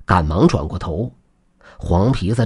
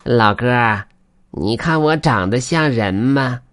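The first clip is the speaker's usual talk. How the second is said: high, drawn-out creature voice; put-on weasel voice, asking for approval; weasel spirit; 4 semitones higher, slower than usual. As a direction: stretch it out slowly, like an eerie little animal. Weasel spirit figure asks.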